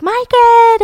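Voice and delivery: high, loud mock holler; calling out. Friends calling Margaret from the car window, imitated excited call. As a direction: imitate hailing a friend with a drawn-out name.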